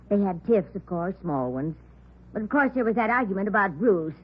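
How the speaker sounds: usual speaking voice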